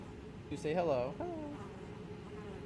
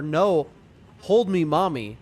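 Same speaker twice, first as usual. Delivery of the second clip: mock needy plea; 9 dB louder, slower than usual. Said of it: "I have two options to say".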